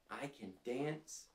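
ordinary delivery, nothing marked

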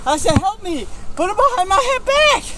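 high, loud, desperate shouting; angry yelling. Real, not acted. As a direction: yell it back in protest. Handcuffed suspect yelling at officer.

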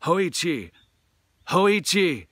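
loud, high urgent calls; calling out. Acted. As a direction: shout for him across the graveyard. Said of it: "the servants called".

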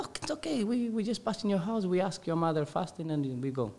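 high, soft imitation of coaxing boys; reassuring friends. Friends persuading him to ask his mother, reassuring. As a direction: wave the worry away, high and soft.

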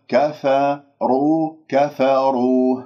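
slow "كفروا", light ka-fa, heavy ru; correct recitation. Shown as a demonstration. Teacher models it rightly, slow كفروا with heavy ra only. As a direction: keep كف thin, make the رو full. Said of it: "هكذا".

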